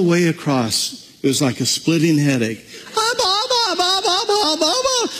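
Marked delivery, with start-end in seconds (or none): 2.9-5.1 put-on very high, piercing little-girl cries; shrill child's screaming. Little girl calling her father at the pool, shrill screaming.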